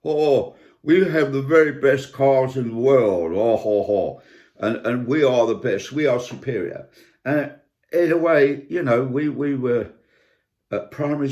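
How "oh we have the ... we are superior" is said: lampoon a smug flag-waver bragging; puffed-up mock boast, oh ho ho; mocking proud Brit. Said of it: "they used to say".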